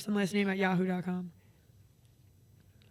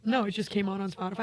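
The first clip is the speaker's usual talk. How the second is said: normal talking voice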